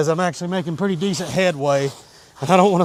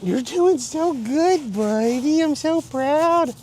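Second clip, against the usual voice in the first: higher, slow sing-song praise for the dog; 7 semitones higher, slower than usual. Real, not acted. Praising dog in baby talk.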